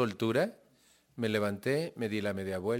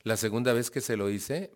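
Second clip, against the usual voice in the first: ordinary delivery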